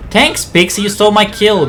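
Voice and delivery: mock-grateful annoyance. Real, not acted. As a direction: grumble at a teammate who robbed you. Annoyed that teammate took kill.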